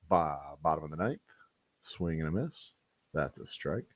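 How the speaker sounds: hushed, low play-by-play; baseball commentator voice. Pretend. Host calling an imaginary strike, hushed baseball voice. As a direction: drop to a soft, low commentator's murmur. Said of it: "I'm gonna do this in my baseball voice".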